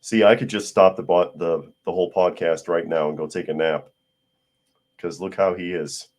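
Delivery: ordinary delivery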